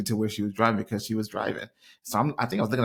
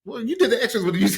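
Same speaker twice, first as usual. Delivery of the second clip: laughing high while teasing; 12 semitones higher, 5 dB louder, faster than usual. Real, not acted. Husband laughing as he teases his wife. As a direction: giggles through the words. Teasing partner mid-argument.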